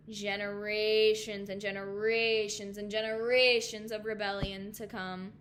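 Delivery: slow, weighty storytelling cadence; grave, measured sermon close; solemn preaching. Real, not acted. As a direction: let each phrase hang, swelling on the repeated words. Solemn closing of her preaching.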